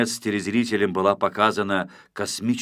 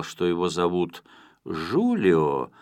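ordinary delivery

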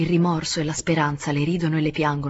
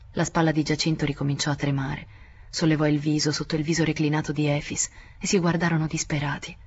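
ordinary delivery

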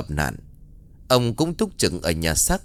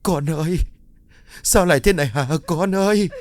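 anguished cry; 6 semitones higher than usual. A narrator's reading. Mother crying over her dead daughter, high wail. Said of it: "ú ớ gọi".

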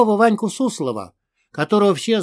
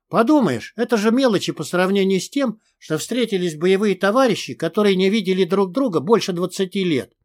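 ordinary delivery

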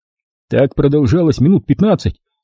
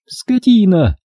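higher-pitched insult through the teeth; hissed insult; 7 semitones higher, slower than usual. A narrator's reading. Dinka insults the shepherd over drinks, through her teeth. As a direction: spit out the insult, teeth held tight. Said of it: "сквозь зубы процедила Динка".

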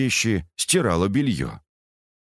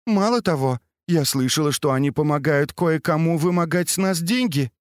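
lightly voiced calm daughter, higher; a sensible daughter reassuring her mother; young woman voice; 8 semitones higher than usual. Baochai speaks at length to mother.

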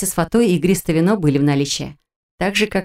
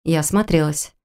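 usual speaking voice

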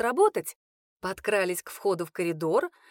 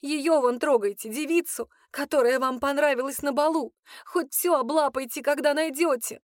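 higher and louder, lashing out through hurt; a hint of a tearful young woman snapping; jealous outburst; 6 semitones higher, 3 dB louder than usual. A narrator's reading. Hurt cook lashing out at the man she loves, loud.

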